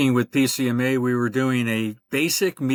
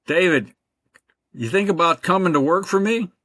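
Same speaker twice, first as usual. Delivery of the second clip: lightly imitated Alabama Southern accent, a bit higher; Birmingham Alabama accent; 6 semitones higher than usual. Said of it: "Roy Evans with a Birmingham accident, accent".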